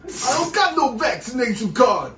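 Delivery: high, loud Brooklyn tough guy; Brooklyn accent. Pretend. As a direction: shout it as a cartoon Brooklyn guy. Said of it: "I'm from Brooklyn".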